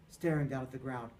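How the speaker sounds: plain reading voice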